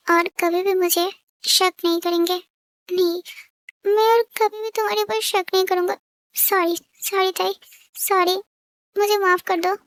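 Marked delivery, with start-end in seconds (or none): none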